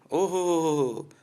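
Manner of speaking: high, loud mocking "oho ho ho"; jeering mock laugh. To the Sikh men, a loud high jeering "oho ho ho". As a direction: sneer with a fake taunting chuckle.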